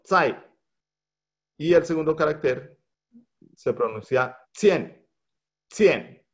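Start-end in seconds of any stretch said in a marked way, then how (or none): 0.0-0.4 Zai said high with a falling fourth tone. Shown as a demonstration. Teacher showing the fourth tone on zai, high and falling. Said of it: "con cuarto tono". 5.7-6.3 Cien said high with a falling tone, the a sounding like e; Mandarin syllable. Shown as a demonstration. Teacher correcting the vowel in cien for students. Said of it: "Ahí hay una A, pero en realidad es como una E".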